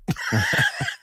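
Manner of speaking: high squeal of laughter; laughing. Real, not acted. Comic laughing at his robot bit, high squealing laugh.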